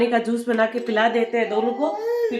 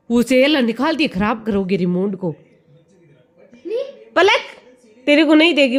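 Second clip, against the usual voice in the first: ordinary talk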